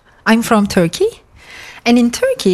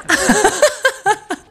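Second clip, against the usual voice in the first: giggle; 12 semitones higher than usual. Real, not acted. Laughs at the coined word.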